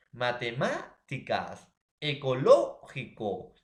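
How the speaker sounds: matemáticas and ecológico said slowly, each syllable laid out, the stressed third-from-last syllable leaned on; drawn out for the class, the stress on the third-from-last syllable of matemáticas and ecológico; slow example words. Shown as a demonstration. Teacher reading example words to students, slow and deliberate. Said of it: "tenemos palabras esdrújulas como".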